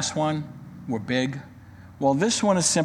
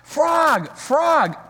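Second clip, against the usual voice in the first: high, louder call of a name; 10 semitones higher, 3 dB louder than usual. Acted. Toad calling for Frog at his house, high call. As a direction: call out to a friend at his door. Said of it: "cried Toad".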